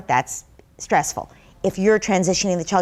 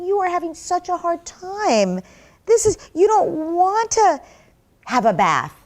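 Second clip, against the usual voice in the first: put-on higher, gentle voice comforting a cranky toddler; soothing parent; 9 semitones higher, 4 dB louder than usual. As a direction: sympathetic mother soothing her two-year-old. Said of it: "you would hold your two-year-old and say".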